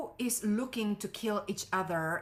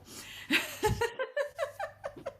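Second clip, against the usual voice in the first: high-pitched giggling laugh. Real, not acted. Coach amused by her own supermodel remark, laughing high. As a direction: break into a long high laugh.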